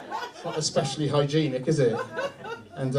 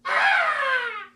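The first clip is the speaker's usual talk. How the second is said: loud, very high scream; sudden high-pitched shriek; imitated child's scream; 8 dB louder than usual. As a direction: mimic a boy screaming in pain. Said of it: "I heard him scream".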